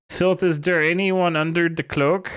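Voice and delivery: mock Swedish accent on a question; Swedish-sounding accent. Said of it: "like, Swedish now".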